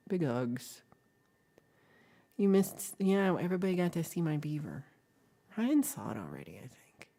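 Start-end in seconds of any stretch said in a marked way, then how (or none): none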